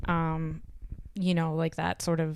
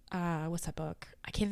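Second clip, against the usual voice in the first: whispering to self; 11 dB quieter than usual. Real, not acted. Trying to recall a title.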